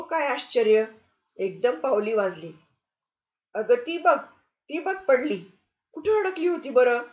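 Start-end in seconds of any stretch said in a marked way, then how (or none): 3.5-7.0 high, loud exclamation of discovery; surprised. Acted. Guest pretends to find Lili's coin, surprised. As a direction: act amazed the coin fell out.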